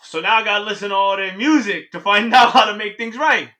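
loud, high-pitched complaint; worked-up disbelief; exasperated outburst. Acted. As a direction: protest the absurd task loudly. Exasperated at the absurd task.

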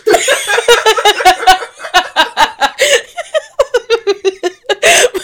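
high helpless laughter; long high laugh. Real, not acted. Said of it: "I'm just laughing".

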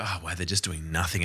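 low, soft aside of a viewer; hushed admiration. Retold or imitated. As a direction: marvel under your breath. Voices a viewer's quiet admiration.

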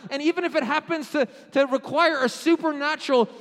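loud, high, sustained sermon fervor; full-force preaching. Real, not acted. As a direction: build urgency and keep pushing.

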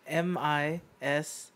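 slow letter by letter; spelling it out. Shown as a demonstration. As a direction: spell each letter out slowly. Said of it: "So the word is mischief".

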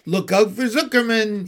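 high, loud alarm; urgent gander shouting danger; yelled warning. A narrator's reading. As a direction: yell a warning across the yard. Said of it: "yelled the gander".

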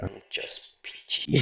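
slow, whispered demonic croak; demonic little thing. Pretend. After failing a class, a tiny demon whispering slowly. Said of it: "this demonic little thing".

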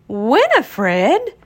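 horrified exclamation. A narrator's reading. Friends hearing a strange name, horrified. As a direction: exclaim it in mock horror. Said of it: "the friends exclaimed, horrified".

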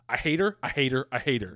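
real frustrated hate for a movie character; angry repetition. Real, not acted. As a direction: spit it out three times, really annoyed. Repeated angry rant about Gita.

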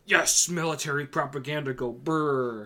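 loud, jeering mock patriot. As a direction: sneer it like a flag-waver. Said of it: "they're the ones who are like".

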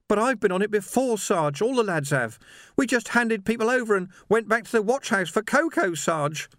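put-on high, anguished young voice; distressed young constable. A narrator's reading. Young Sam confesses to his sergeant, anguished.

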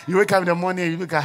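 normal talking voice

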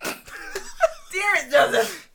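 high squealing laugh into a shout; laughing outburst. Real, not acted. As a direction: laugh then yell his name. Mock outrage at friend.

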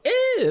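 high squeaky eh noise; goofy high-pitched eh; funny noise. Pretend. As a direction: make a silly little yelp. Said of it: "That was the noise".

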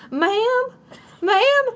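high, hammed-up hillbilly accent; put-on hillbilly accent. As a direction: imitate a woman calling out in a high hillbilly accent. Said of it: "When did she get an accent?".

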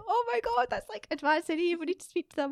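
high, giddy exclamation at normal volume; retold excitement. Host retells recognising the band on radio, excited. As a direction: relive the discovery, voice shooting up.

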